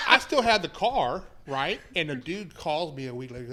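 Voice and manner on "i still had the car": loud, higher-pitched insistence; raised voice. Real, not acted. Storyteller talking over laughing friends to resume his story, loudly insistent. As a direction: push the line out over the laughter.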